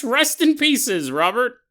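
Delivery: mock eulogy punchline; punning emphasis. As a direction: land the pun. Pun on rest in peace.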